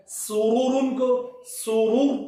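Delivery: سرور read slowly, final ر held thick and still; no qalqalah, slow. Shown as a demonstration. Teacher shows a stop on ر with no qalqalah. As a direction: stretch out سرورن کو سرور without bouncing the ر. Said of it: "یہاں پہ قلقلہ نہیں ہوگا".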